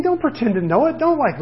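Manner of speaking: normal talking voice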